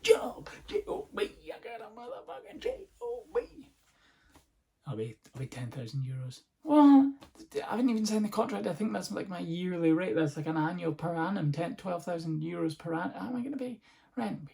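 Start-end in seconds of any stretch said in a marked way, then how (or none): 0.0-3.7 high, whispered hammed-up sob; whisper-weep it like overwhelmed tears; mock crying joy. New hire telling dance police about the job, in a mock tearful whisper. 6.6-14.5 much higher, fast mock panic; panicked protest. Fined dancer protesting to dance police, in hammed-up high panic.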